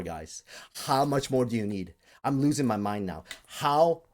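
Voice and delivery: ordinary talk, nothing marked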